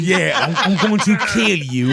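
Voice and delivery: mock-British threat, lightly put on; UK accent. Host joining in on the villain joke, light British accent. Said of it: "convincing with his U K accent".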